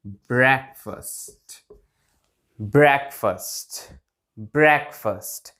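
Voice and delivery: slow ब्रेकफास्ट, ending like 'first'; correct pronunciation. Shown as a demonstration. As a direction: model ब्रेकफास्ट slowly as BREK-fust. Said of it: "like that". (धीरे-धीरे 'ब्रेक-फ़स्ट', छोटे 'अ' के साथ)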